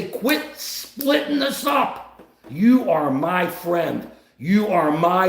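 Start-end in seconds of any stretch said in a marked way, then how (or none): 0.0-2.2 slow, high, drawn-out plea to quit; emphatic demand. Acted. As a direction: demand it word by word, pitched up. Acted demand to leaders.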